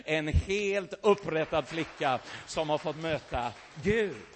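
ordinary talk, nothing marked

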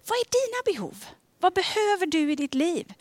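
high, lifted questioning voice. Acted. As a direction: ask it high and open, reaching out. Preacher voices Jesus asking about needs.

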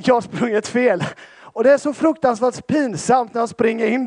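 loud, high, full-force preaching of a funny story; animated preaching. Acted. Pastor tells the church his race blunder, loud and lively.

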